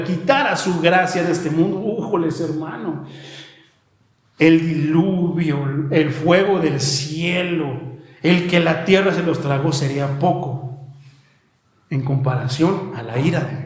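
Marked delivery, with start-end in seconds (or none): none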